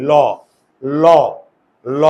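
repeated short ল as a prompt; sounding out the first sound; sounding out syllable. Shown as a demonstration. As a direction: tap out ল ল ল for the class. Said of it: "আমরা কি বলি?".

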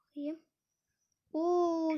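ordinary delivery

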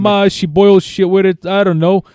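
put-on high, pushed Jersey accent. Caller explaining to the deli why he needs blood, hammed-up Jersey accent. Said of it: "I go into my Joyzy accent".

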